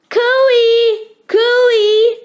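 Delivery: long high loud call across the bush; yelled cooey. A narrator's reading. Family calls for help at the tops of voices. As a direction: call out to be found. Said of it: "At the tops of their voices they yelled".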